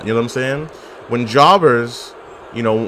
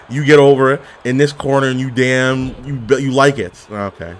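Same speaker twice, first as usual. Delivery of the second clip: ordinary talk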